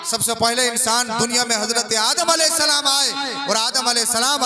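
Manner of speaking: high, loud rhetorical oratory; full-force preaching. Acted. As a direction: proclaim it to the whole crowd, high and loud. (पूरे ज़ोर की बुलंद तक़रीर)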